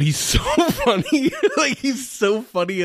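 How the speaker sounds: high, giggling through the words; laughing. Real, not acted. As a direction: laugh it out, delighted. Gushing over funny character.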